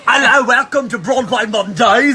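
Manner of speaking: loud, high, sing-song Cockney; hammed-up Cockney show greeting; Cockney accent. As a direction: belt out a welcome in mock Cockney. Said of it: "some offensive Cockney accents".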